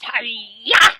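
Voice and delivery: loud mock ninja cry; karate yell. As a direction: shout it like a playful martial arts kick.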